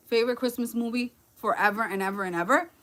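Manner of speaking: normal talking voice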